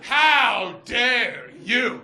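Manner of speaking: very slow, loud theatrical rebuke; mock outrage. Wronged lover's final rebuke to the woman, drawn-out mock outrage. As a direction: stretch each word in hammed-up indignation.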